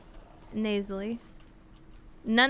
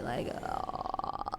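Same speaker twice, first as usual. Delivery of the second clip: long creaky "uh" demo; soft, exaggerated vocal fry; 9 dB quieter than usual. Shown as a demonstration. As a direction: drop into a drawn-out croaky fry. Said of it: "vocal fry?".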